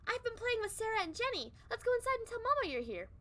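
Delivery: put-on high, small child's reply; little girl voice. Sally answering her mother in a high little-girl voice. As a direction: answer sweetly like an eight-year-old.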